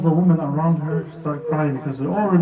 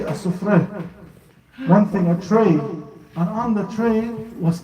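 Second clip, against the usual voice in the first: majlis lament recital; 6 semitones higher than usual. Acted. Preacher narrating the covered tray to mourners, mournful recital. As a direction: build the grief toward the reveal.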